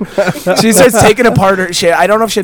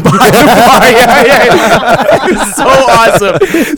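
higher, excited laughing delivery; 5 semitones higher, 4 dB louder, slower than usual. Real, not acted. Telling friends about a phone in a jug, laughing. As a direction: break up laughing as you speak.

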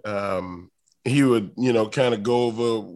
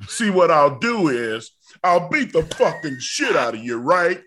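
aggressive wrestler voice, pitched up; mock-menacing, higher-pitched bravado; Ron Simmons impression; 4 semitones higher, 3 dB louder than usual. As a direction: imitate a tough wrestler laying down the plan. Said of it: "that's my Ron Simmons impression".

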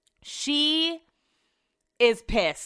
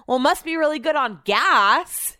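tipsy loud remark; 6 semitones higher, 6 dB louder than usual. Retold or imitated. Drunk Brett to driver.

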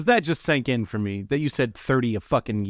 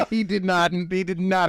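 laughing through the words, pitched up; amused, higher, breaking up laughing; real laughter; 6 semitones higher than usual. Real, not acted. Laughing at the storyteller.

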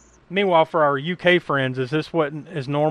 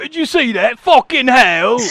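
loud, high, hammed-up Cockney soldier; mock British soldier; 9 semitones higher, 8 dB louder, slower than usual. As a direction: yell it like a cartoon Cockney trooper, dragging the last words. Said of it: "trying to sound like one of those soldiers".

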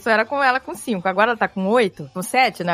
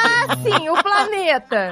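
put-on high, slow, laughing skeptical kid; sarcastic child; 9 semitones higher, much slower than usual. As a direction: drag it out like an unconvinced kid. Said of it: "Aí ela". (menina irônica, arrastando e rindo)

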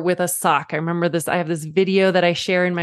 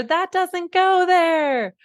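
put-on high, shouted child voice; kids yelling out; 11 semitones higher, much slower than usual. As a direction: call it out like an excited kid in the crowd. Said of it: "the kids in the audience all yell out".

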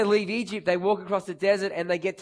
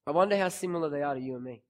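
softer, faster, lower aside; quiet quick repeat; 6 semitones lower, 6 dB quieter, much faster than usual. Shown as a demonstration. Preacher repeating the question to the congregation, quiet and quick. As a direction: toss it off quietly, almost to yourself.

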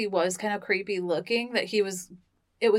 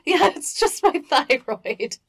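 slow, higher mocking voice; mock excuse; 7 semitones higher, 4 dB louder, slower than usual. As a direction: drag out the lame excuse.

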